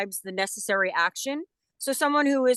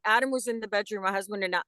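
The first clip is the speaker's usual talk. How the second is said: ordinary talk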